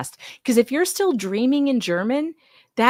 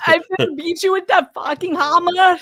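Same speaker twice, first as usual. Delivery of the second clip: higher, louder mock German accent; German barbarian; 9 semitones higher, 6 dB louder than usual. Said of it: "that was spot on".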